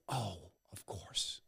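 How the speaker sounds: slow, hushed "oh, of course"; a quiet sigh of understanding; soft dawning realization. Pretend. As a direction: let it dawn on you, softly and slowly. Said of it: "You get there and you go".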